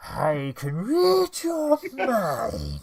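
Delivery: slow, eerie psychic villain; drawn-out, otherworldly mind-reader voice; Psycho Mantis voice. Pretend. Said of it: "Psycho Mantis impression".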